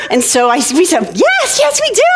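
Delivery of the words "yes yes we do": very high, eager squeal; acted childish excitement; excited little girls. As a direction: answer like thrilled kids on a ride. Girls eagerly answering the boy.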